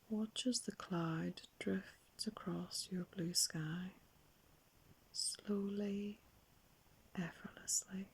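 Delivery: hushed, drawn-out, soothing meditation voice; guided meditation. Acted. Adult guiding children to watch clouds drift by, very soft soothing meditation. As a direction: softly lull them, stretching words and leaving long pauses.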